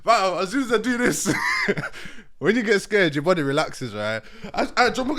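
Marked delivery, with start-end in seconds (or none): none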